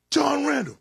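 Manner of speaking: coach's holler, high and loud. Retold or imitated. Coach calls his player over at practice, hollering. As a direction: call the player out across the field. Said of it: "would be hollering my name".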